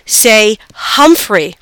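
slow, careful prompting; gentle correction. A narrator's reading. Sister corrects her toddler brother, gently and slowly. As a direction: stretch it out gently to teach. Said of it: "Saya gently corrected him".

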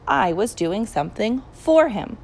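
ordinary delivery

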